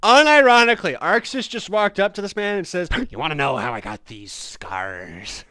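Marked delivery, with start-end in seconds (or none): 3.1-5.4 lower, ominous line about the scars; menacing quote. Retold or imitated. Arxis walking up to a stranger, lightly voiced menacing tone. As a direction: lean into it like a creepy villain asking.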